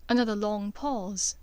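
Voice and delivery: usual speaking voice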